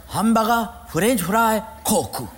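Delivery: hamburger said as hambaga, broken Japanese English; Japanese accent. Retold or imitated. Learner repeating new words to the teacher in Japanese accent.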